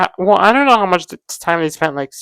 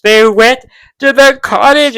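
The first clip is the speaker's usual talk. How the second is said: higher, louder, drawn-out fangirl gushing; excited emphasis; 7 semitones higher, 10 dB louder, slower than usual. Real, not acted. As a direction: stretch it slowly and loudly in delight. Excited recap, repeated emphatically.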